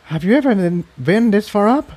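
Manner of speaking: high gentle pony voice; a high worried young mare; concerned filly. Acted. Tiptoe asks Jigsaw.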